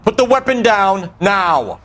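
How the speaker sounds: shouted command. Acted. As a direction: bark the order. Cop ordering suspects to surrender.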